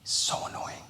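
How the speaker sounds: breathy unvoiced gossip whisper; sneaky classroom whisper, barely audible. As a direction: whisper it behind the teacher's back. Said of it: "she whispers".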